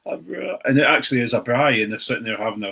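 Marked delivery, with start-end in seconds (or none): 0.1-0.6 a bra said higher but softer in a mock South African accent. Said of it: "the South African accent".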